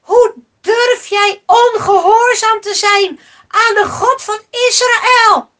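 high, loud, emphatic reproach; outraged shouting. A narrator's reading. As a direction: shout your disbelief at his nerve. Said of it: "riepen de mannen meteen". (verontwaardigd, luid roepen)

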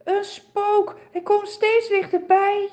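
trembling with fear. A narrator's reading. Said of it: "Ze trillen van angst".

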